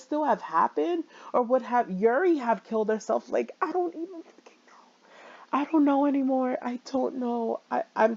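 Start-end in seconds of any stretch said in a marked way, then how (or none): 3.3-4.9 hushed despairing whisper; real overwhelmed whisper. Real, not acted. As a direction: let it fall to a whisper. Overwhelmed by the game.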